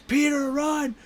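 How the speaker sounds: high, strained retold yell; mock scream. Happy screaming from the car to Peter, high mock yell. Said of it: "he starts just screaming from the car".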